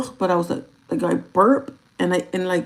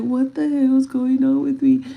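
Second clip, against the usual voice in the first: stressed, raised panicky voice; panicked; 5 semitones higher, 6 dB louder than usual. Retold or imitated. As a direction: panic out loud. Stressed at three in the morning.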